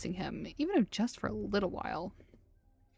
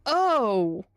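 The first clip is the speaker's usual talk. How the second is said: loud, high shocked yelp; shocked outburst; 7 semitones higher, 6 dB louder, slower than usual. Real, not acted. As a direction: blurt it out, startled by the face. Shocked at character's face.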